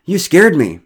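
high, loud rattled complaint; startled, out of breath. A narrator's reading. Derek to the neighbours who frightened him, winded and loud. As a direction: let the fright burst out. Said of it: "a bit out of breath".

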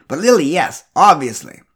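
loud, knowing and dismissive. A narrator's reading. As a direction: wave it away with certainty. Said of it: "she said dismissively".

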